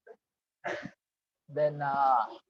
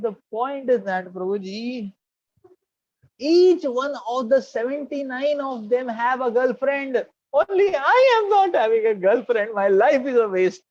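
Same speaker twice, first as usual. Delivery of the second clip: high, loud, slow mock despair; lovesick lament; 7 semitones higher, 5 dB louder, slower than usual. Student confessing to the monk he has no girlfriend, mock despair.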